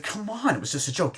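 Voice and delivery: lightly hammed-up protest; acted protest. Acting out film character's protest.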